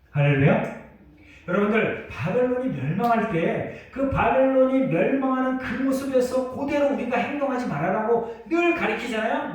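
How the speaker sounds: ordinary talk, nothing marked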